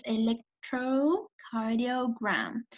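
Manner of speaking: "electrocardiogram" very slow, syllable by syllable; slow clear term. Shown as a demonstration. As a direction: read "electrocardiogram" out slowly. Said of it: "So it says". ("electrocardiogram" muy lenta)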